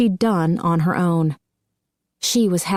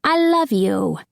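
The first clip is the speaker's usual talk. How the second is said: put-on little girl's I love you, higher; gushing child; 5 semitones higher, slower than usual. A narrator's reading. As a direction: pour it out like an adoring child. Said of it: "she gushed".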